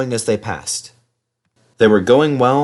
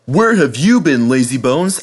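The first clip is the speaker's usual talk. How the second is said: Henry teasing; 4 semitones higher, 4 dB louder than usual. Acted. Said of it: "asked Henry".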